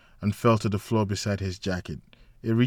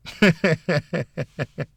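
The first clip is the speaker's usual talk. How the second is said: light laugh; 7 semitones higher, 4 dB louder than usual. A narrator's reading. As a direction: laugh at your own joke. Mara laughing at punchline.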